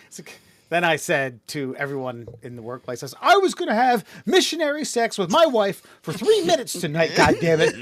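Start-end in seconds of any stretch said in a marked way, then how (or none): 3.2-7.7 high, loud mock tirade; hammed-up outrage. Mocking the officer to everyone at work, hammed-up outrage. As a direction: wail it like a wronged husband. Mocks colleague before whole workplace.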